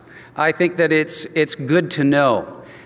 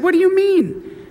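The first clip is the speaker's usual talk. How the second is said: shocked disbelief; 14 semitones higher than usual. Retold or imitated. Pastor retells shocking news from wife.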